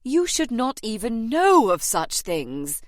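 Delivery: slow, high, loud scandalised reproof; agent's shocked, emphatic voice; embarrassed expostulation. A narrator's reading. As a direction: scold her, mortified by the topic. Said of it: "turning puce with embarrassment".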